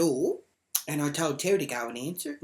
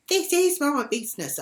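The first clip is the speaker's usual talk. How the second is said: mocking high voice; 12 semitones higher, 6 dB louder than usual. Retold neighbour quarrel, high voice.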